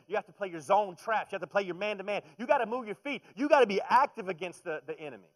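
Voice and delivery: loud, high fired-up preaching; preaching at full force. Acted. Preacher urges believers like a coach, loud and high. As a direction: drive the defense point home hard.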